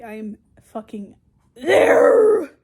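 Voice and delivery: slow, high, drawn-out shout; emphatic yelling. Real, not acted. Insisting on being focused at register, emphatic yell. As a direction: bellow the last word, stretched long.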